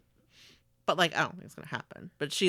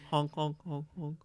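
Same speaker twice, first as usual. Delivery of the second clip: low mock goose honking; goose honks; 4 semitones lower, 7 dB quieter than usual. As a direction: honk like a silly goose. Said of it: "It's a goose".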